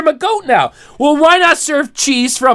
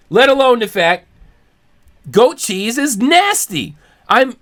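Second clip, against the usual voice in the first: ordinary delivery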